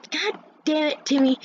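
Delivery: acted fed-up cursing; exasperated. Student fed up with classmate's noise, exasperated.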